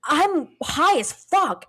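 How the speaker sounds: loud, high and slowly stretched out; retold blurted confession.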